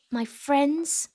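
ordinary delivery

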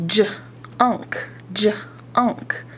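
"J-unk" whispered in two parts; whispered sounding out. Shown as a demonstration. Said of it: "whispered blending".

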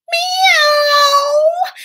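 loud, high, stretched meow; cat meow. Acted. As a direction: announce yourself with a big yowl. Puss meows at the woodcutters.

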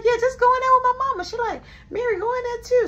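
put-on high, loud, fast kid voice; bossy little kid. Little girl sending the adults off to her mama, high and bossy. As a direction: boss them around like a small child.

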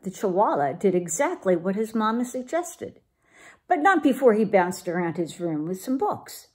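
plain reading voice

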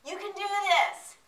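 high, drawn-out call of encouragement; slow, high-pitched reassurance from above; guide calling down. Retold or imitated. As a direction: imitate a climbing guide calling down slowly. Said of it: "the guide above me saying".

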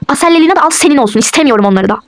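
ordinary talk, nothing marked

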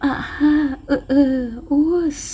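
high, wavering mock wail of a ghost; mock ghostly moaning. She imitates the night noises to her boss, mock ghostly wail. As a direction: moan it out like a hungry ghost, on purpose. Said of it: "เธอเรียนเสียงอย่างจงใจ".

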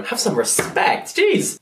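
slow scolding, then a high squeaked exclamation; playfully scold, jumping up high at the end; mock indignation. Vlogger correcting her own slip, mock indignation.

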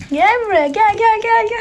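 high, loud coaxing call; pet talk. Real, not acted. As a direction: call the calf over eagerly. Calling calf to come. (yüksek ve yüksek sesle çağırma)

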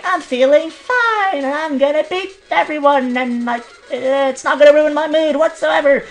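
high, slow, sing-song mockery of his earlier cheerful self; mocking past self. Gamer mocking his earlier self, high, slow sing-song. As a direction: parody your own naive confidence, drawn out and high. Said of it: "I was like".

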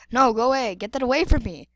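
loud, quick demand; urgent and loud; loud alarm. Acted. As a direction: push it away with a loud order. Fending off threat, then repeats.

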